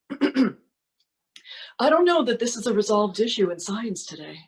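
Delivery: slow, lightly put-on politician voice; a measured, hedging statesman, lightly imitated; Mike Pence. Performer quoting a politician on science, slow impression. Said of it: "the second one is from Mike Pence".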